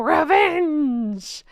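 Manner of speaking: drawn-out, mock-dramatic "revenge"; running-gag revenge. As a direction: stretch the word out theatrically. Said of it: "I gotta say it like that".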